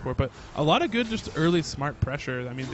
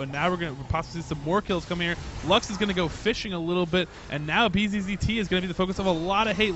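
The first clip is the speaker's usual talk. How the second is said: excited play-by-play; 5 semitones higher, 4 dB louder than usual. Acted. Caster hypes a teamfight.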